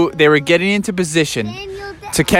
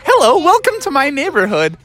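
higher, cheery welcoming voice; friendly Daniel Tiger; 10 semitones higher than usual. Acted. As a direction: greet the surfers like a kind cartoon tiger. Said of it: "he was like".

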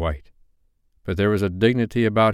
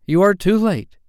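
high, loud boast; proud cry; 11 semitones higher, 6 dB louder than usual. A narrator's reading. Tootles to the other boys, loud proud cry. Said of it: "he cried proudly".

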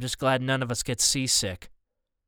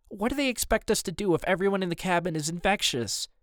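higher-pitched worried question; a little scared; 6 semitones higher than usual. A narrator's reading. Mom worries about the infectious cabin, a little scared. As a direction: ask with fear starting to show. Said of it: "sounding a little scared".

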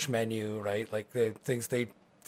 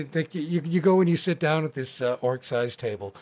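usual speaking voice

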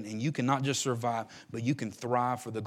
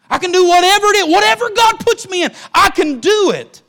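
much higher, louder declaration; Paul's loud cry; 17 dB louder than usual. Retold or imitated. Preacher voicing Paul's endurance to church, loud ringing cry. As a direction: shout Paul's defiance to the back row. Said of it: "He said".

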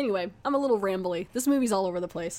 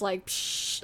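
whooshing psh noise; 3 dB quieter than usual. Retold or imitated. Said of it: "ripping the crap out of their hand".

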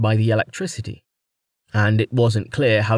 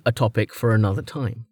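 usual speaking voice

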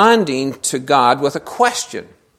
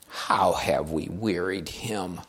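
quoted question; 8 semitones lower, 9 dB quieter, slower than usual. Retold or imitated. Voicing the people's question in reading.

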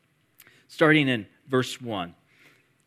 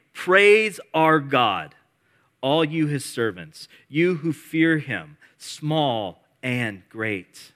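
slow, loud, solemn call; voice from the throne; 5 dB louder, much slower than usual. Acted. Voice from the throne calling servants to praise, solemn. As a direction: command them to praise. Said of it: "came a voice saying".

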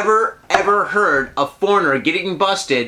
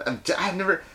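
normal talking voice

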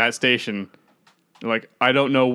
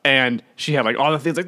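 normal talking voice

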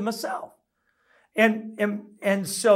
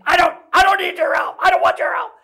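angry shout, much higher and louder; raging; 9 semitones higher, 5 dB louder, faster than usual. Retold or imitated. Husband raging at his wife over her offer of help. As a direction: snap it out in a rage. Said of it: "kind of raging at that moment".

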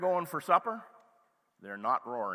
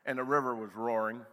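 usual speaking voice

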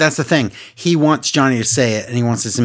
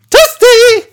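very high, loud falsetto shout; Mortal Kombat pop-up guy; 9 dB louder than usual. Retold or imitated. Answering the co-host's game question, shrill falsetto impression. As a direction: squeal it like a video game catchphrase. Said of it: "what's the guy say when he sticks his head in".

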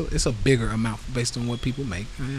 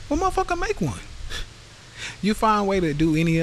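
much higher, incredulous snap; exasperated retort; 9 semitones higher than usual. Retold or imitated. Host answering the no-Facebook excuse, incredulous. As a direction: fire it back, fed up and amused. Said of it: "That's exactly what I tell 'em".

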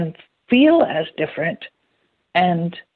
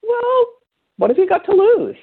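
high-pitched shrug; 13 semitones higher than usual. Retold or imitated. Answers an imagined doubter.

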